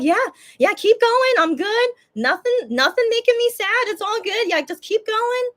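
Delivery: high-pitched, bubbly insistence that all is fine; eager cheering on. Retold or imitated. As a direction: egg them on in a high, upbeat voice. Retold cheering encore on, insisting she's good.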